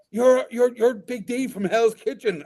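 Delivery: high, slow, awed fan; imitating excited fan. Fan naming Big D, high and awed. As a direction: spell out the recognition in awe. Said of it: "then they're like".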